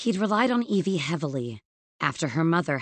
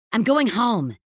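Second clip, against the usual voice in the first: higher, louder retort; tossed back; 5 semitones higher, 5 dB louder than usual. A narrator's reading. Evie leaving her boss behind, tossed back loudly. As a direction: throw it back over a shoulder. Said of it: "she tossed back".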